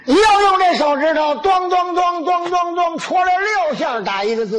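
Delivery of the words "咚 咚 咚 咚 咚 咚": high, rapid run of knocks; six thuds sung out on one high pitch; imitated thudding. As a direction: mimic repeated jabs at a wall. Acts out six jabs at wall.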